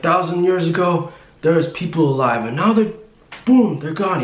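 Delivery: high, worked-up mock rant; retold yelling. Imitating the driver preaching at passenger, mild mock yell. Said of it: "he's yelling at me".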